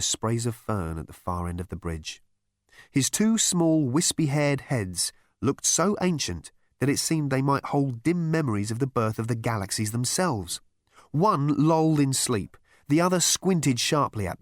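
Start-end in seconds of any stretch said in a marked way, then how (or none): none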